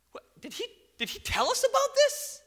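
rising high, loud in disbelief; suddenly realising he'd said it; astonished. Retold or imitated. Peter and John at the empty tomb, mock astonishment. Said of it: "they're like".

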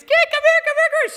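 high, loud panicky mock shriek; imitated mother's yell. Mom calling kid away from running dog, imitated high yell. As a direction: mimic an alarmed mom calling urgently. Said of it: "she yells to her kids".

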